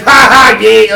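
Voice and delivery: high, very loud fake laugh; loud and obnoxious. Mimicking annoying guy in the front row, loud obnoxious heckle. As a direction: bray a forced ha ha at top volume. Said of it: "loud and obnoxious in the".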